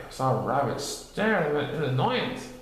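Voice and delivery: ordinary delivery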